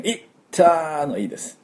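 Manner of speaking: いったー yelped high like after bumping yourself; high sharp いったー; ouch-like い. Shown as a demonstration. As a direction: say いったー like a sudden little ouch. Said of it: "ぶつけた時の".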